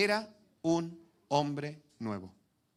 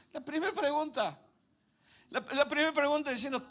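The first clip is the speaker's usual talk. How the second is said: much higher, louder sermon voice; fervent preaching; 8 semitones higher, 4 dB louder than usual. Acted. Preacher retelling Joseph's story to the congregation, fervent and high. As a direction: preach it out to the back row.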